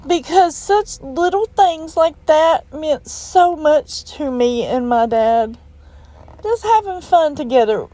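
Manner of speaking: holding back real tears while speaking; real crying, voice cracking up high. Real, not acted. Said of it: "I don't mean to cry on you".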